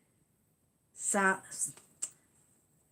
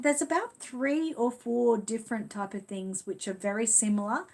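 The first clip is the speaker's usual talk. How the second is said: ordinary talk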